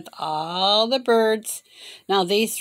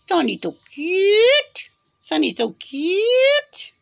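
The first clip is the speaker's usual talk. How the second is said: high, drawn-out coo on "cute"; 10 semitones higher, much slower than usual. Real, not acted. Owner fussing over Sonny the bird, high doting coo. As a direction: stretch it out high for a pet bird.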